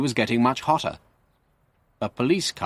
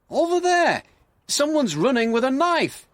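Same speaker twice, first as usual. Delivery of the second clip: alarmed call. Acted. Constable alarmed, pointing out man.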